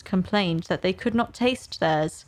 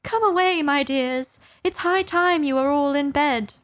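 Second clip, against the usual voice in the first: put-on high, anxious call to children; a mother bird scared for her chicks; trembling; 6 semitones higher than usual. Canary calling its children away, high and trembling. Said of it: "in a trembling voice".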